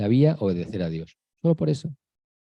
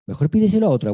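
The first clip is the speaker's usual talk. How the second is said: pleading to God; 7 semitones higher, 3 dB louder, faster than usual. Retold or imitated. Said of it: "le decimos a Dios".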